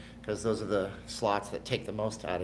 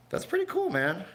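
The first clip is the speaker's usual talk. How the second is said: much higher, excited "pretty cool"; impressed; 10 semitones higher, 3 dB louder than usual. Real, not acted. Streamer praising a viewer's bike video, excited. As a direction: gush over the viewer's video.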